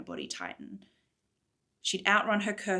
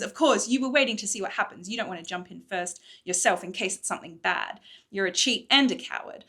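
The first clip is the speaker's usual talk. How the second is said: louder, biting scorn; angry accusation; 6 dB louder than usual. Acted. Morrigan accusing Cadence of cowardice, angry. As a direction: call her a coward to her face.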